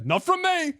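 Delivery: high-pitched frantic disclaimer; hasty clarification. Retold or imitated. Clearing himself to a startled stranger, frantic disclaimer. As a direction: blurt it out high to correct a misunderstanding. Hastily reassuring the startled girl.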